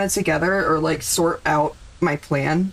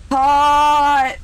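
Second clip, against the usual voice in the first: shout; 7 semitones higher, 7 dB louder than usual. Real, not acted. Cheering for a pot donation.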